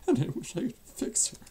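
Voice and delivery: fighting back tears, pitch climbing; starting to cry. Acted. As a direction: voice rising into tears. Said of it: "I don't normally cry like this".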